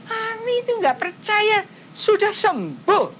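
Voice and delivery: put-on high, bright little kid's voice, second line slowed; child telling mum she's already well; eight-year-old child. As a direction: voice the small daughter insisting she's healed. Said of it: "anaknya itu ngomong apa tahu? Umur delapan tahun".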